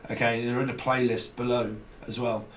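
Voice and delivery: usual speaking voice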